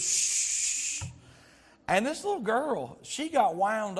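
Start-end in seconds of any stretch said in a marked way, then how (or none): 0.0-1.0 hushing shh. Retold or imitated. Stewardess hushing screaming girl.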